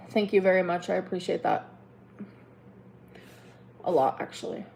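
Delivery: low, quiet, dry sarcasm; sarcastic thanks. Real, not acted. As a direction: thank them without meaning it.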